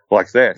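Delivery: slow heavy breath imitating a creature; imitated heavy breathing. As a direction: exhale like a winded beast. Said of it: "It was going like".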